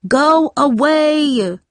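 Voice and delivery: high, drawn-out yell. A narrator's reading. Jamie yells at her sister knocking, high drawn-out yell. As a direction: yell it slowly through a shut door. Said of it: "Jamie yelled".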